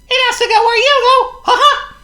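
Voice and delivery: very high, loud laughing mouse; a tiny mouse giggling at his idea; squeaky laughing mouse; Squeak the mouse. Acted. Voices Squeak.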